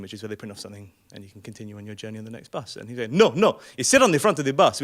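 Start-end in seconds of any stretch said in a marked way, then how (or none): 3.1-4.8 loud, insistent imitation; mock shouting driver. Bus driver answering the passengers, mock shouting. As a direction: play the driver shouting back. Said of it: "bus driver shouting in response".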